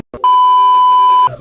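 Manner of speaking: shrieking laugh; sudden high squealing laugh; burst of high laughter. Real, not acted. Reacting to tasting the sauce.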